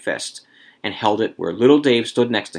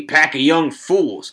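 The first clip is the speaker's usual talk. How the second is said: slow, higher, stout scorn; 5 semitones higher, 4 dB louder, much slower than usual. A narrator's reading. Old man scorning the young people, stout. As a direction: dismiss them stoutly, drawing it out. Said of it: "Old Man Warner said stoutly".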